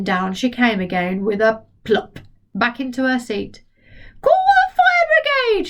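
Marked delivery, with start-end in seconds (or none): 4.2-5.7 put-on very high screeching old woman; shouted. Grandma screams for the fire brigade, high screech. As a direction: screech it in panic. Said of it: "she shouted".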